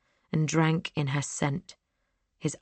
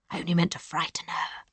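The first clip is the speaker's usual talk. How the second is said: soft whispered excuse; whispered confession; 2 semitones higher, 3 dB quieter than usual. Acted. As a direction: breathe it out as an excuse. Brother confesses about Sophie.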